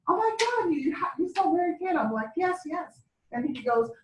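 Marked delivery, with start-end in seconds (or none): 0.1-2.0 high-pitched imitation of delighted surprise; thrilled customer. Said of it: "She goes". 2.4-3.0 high, softish retold yes-yes; eager confirmation. Answers thrilled customer in retold scene.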